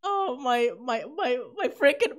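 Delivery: ordinary delivery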